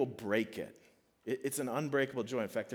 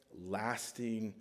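ordinary delivery

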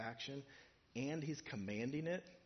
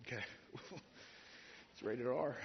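usual speaking voice

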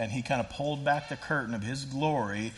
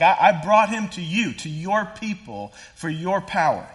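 pleading father; 5 semitones higher, 8 dB louder than usual. Retold or imitated.

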